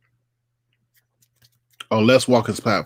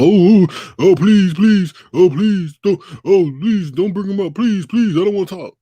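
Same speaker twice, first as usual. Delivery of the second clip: high, louder whiny pleading; mock frantic begging; 8 semitones higher, 6 dB louder than usual. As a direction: ham up a terrified coward begging. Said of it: "sit up there and be like".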